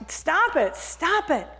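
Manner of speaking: slow then high stop it; protesting. Acted. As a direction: push him away with the words. Teacup protests the fumes.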